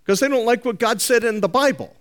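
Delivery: mock their smug plan, rattled off quickly; a hammed-up scoffer's boast. Mocking people who rewrite the Bible.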